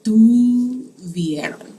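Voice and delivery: teacher modelling the ustedes form slowly; slow model phrase. Shown as a demonstration. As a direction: stretch "tuvieron" out slowly. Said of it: "y'all had a test".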